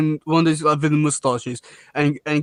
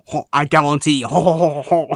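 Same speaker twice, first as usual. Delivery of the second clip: put-on French villain accent. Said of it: "Leatherhead should be French".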